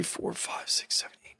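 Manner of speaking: whispered count, trailing into silence; tally it up in a whisper to yourself; barely audible muttered counting; counting under breath. Real, not acted. Counting missed cuts to himself.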